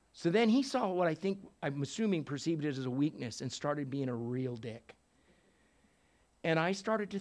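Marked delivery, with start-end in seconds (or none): none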